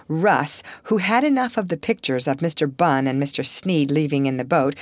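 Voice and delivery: usual speaking voice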